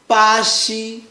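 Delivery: BA XI, both syllables long and level; first tone. Shown as a demonstration. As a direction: hold ba and xi flat on the same pitch. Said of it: "make sure it's flat".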